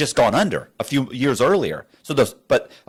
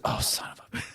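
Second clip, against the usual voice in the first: a muttered curse under the breath; whispered curse; 11 dB quieter, faster than usual. Retold or imitated. Agent realizes statements were faked.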